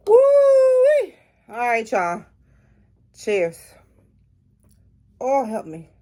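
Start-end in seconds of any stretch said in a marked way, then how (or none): 0.1-1.1 loud high whoop of shock; excited whoop. Real, not acted. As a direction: let out a wild woo-wee. First sip of the spicy drink.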